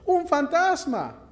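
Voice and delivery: high, slow, alarmed shout of the disciples; frightened cry. A narrator's reading. Disciples on the boat seeing a ghost, high frightened cry. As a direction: cry out like terrified fishermen. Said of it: "se turbaron, diciendo".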